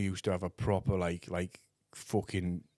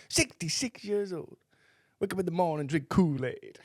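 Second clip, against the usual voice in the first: imitating old boxer; 7 semitones higher than usual. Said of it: "He said to me, like".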